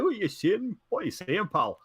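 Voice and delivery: imitating a thick Scottish man; Scottish accent. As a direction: do a proper Glaswegian pal voice. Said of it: "he's proper".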